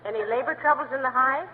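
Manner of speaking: ordinary talk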